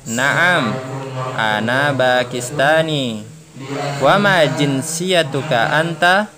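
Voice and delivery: slow, clearly spaced Arabic dialogue read-aloud; slow model dialogue reading. Shown as a demonstration. Teacher reading a model dialogue to students, slow and clear. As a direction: read each line slowly for the class to repeat. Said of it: "langsung saja nanti saya kasih tanda ya".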